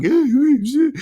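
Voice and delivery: high, loud, alarmed squeal of a fretting car; panicky car voice. Retold or imitated. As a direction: voice the car panicking over the car in front. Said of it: "the technology got in my way and was like".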